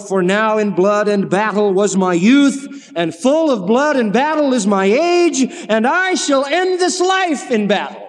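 slow, rising poem recital. Shown as a demonstration. Preacher reciting Matthew Arnold to the congregation, slow and rising. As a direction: read the lines slowly, building. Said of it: "Matthew Arnold wrote".

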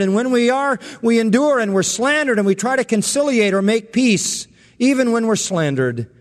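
indignant, sarcastic lament. Retold or imitated. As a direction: pile up the grievances with scorn. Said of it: "Boy, what a sarcastic attack".

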